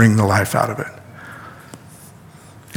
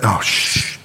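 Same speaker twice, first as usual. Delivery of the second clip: soft warning whisper; shushing a friend in the temple; hushed whisper; 5 semitones lower, 6 dB quieter than usual. Acted. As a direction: hush him under your breath. Hushing talk about him in the temple.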